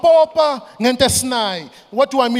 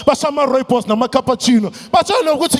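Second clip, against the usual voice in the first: normal talking voice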